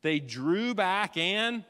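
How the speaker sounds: drawn-out, deliberate preaching; slow dramatic build. Acted. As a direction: stretch every word. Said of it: "preacher slows for effect".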